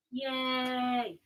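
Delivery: high, drawn-out yay; soft little celebratory singsong; small cheer. Real, not acted. Host cheering the sourdough bread plan, small high cheer.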